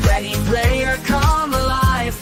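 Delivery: ordinary talk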